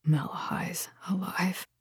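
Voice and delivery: very soft, stunned whisper. A narrator's reading. Horrified man repeating the news to her, whispered. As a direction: breathe it out in horror, barely voiced. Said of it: "Then he whispered".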